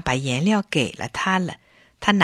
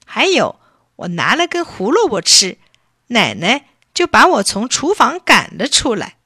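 put-on wronged child's raised, emphatic complaint; aggrieved, near tears; 5 semitones higher, 5 dB louder than usual. A narrator's reading. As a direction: complain loudly like a hurt little boy. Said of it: "委屈的都快哭出来了". (委屈地诉苦)

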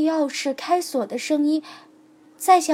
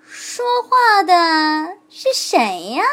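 slow, wary fox voice; puzzled fox; 4 semitones higher, 7 dB louder, much slower than usual. A narrator's reading. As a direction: ask like a suspicious fox. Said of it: "他问道". (狐狸慢慢地、疑惑地问)

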